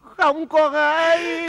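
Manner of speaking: a touch of hammed-up wailing, high and slow; hammed-up weepy wail; mock wail.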